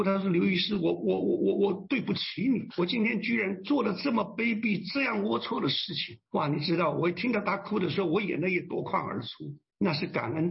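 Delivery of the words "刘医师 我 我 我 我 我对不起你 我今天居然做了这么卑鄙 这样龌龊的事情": raised, stammering mock sob; retelling his sobbing apology. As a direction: act out a broken, tearful confession, lightly. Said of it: "哇，他痛哭". (带哭腔地结巴着道歉，略带模仿)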